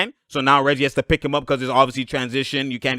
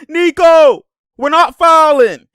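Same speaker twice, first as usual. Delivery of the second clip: high, loud, slow mock protest; mock a player crying to the ref; 13 semitones higher, 11 dB louder, slower than usual. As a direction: whine it like the whining player.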